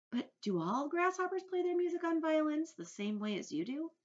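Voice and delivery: put-on high, loudish curious boy; James. A narrator's reading. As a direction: ask like an eager child.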